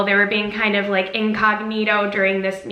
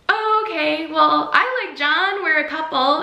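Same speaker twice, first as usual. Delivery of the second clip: polite pushback; 6 semitones higher than usual. Retold or imitated. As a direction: gently set a fan straight, pitched up. Politely fending off a smitten fan.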